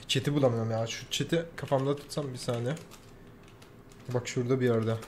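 soft, low searching mumble; muttering. Real, not acted. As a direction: mumble to yourself while looking. (kendi kendine mırıldanma)

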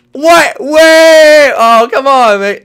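very high, loud disbelief; startled shouting. Real, not acted. Player caught off guard, loud high protest. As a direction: yelp it out in protest.

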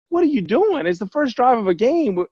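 the coach shouting what are you doing; coach's stunned shout. Retold or imitated. Coach Fox yelling at him.

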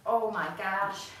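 winded, slow and breathless; catching breath after hard reps; after a workout. Real, not acted. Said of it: "Legs are on fire".

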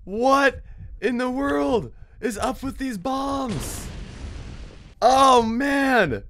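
high, drawn-out disbelief; exasperated outcry. Real, not acted. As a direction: wail it in frustration. Keeps hitting bombs.

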